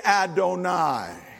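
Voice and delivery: Adonai said slowly with a laugh; slow demo. Shown as a demonstration. Pastor teaching the congregation to say Adonai, slow. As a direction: draw out Adonai slowly, amused.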